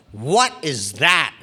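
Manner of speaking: slow, drawn-out horrified question; mock appalled. Woman backing into man with halo, mock appalled. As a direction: stretch each word in mock disgust.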